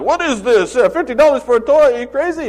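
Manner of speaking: loud, high mock Italian accent yelling about the price; hammed-up Italian accent, a father outraged at the price; bad Italian accent, high and loud. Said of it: "don't know how he became Italian".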